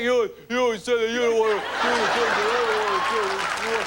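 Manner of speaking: mock dumb male voice, lightly put on; imitating the man. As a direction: parody the boyfriend's way of talking. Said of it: "imitate the speech of the man".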